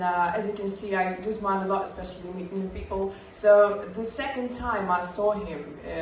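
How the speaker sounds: normal talking voice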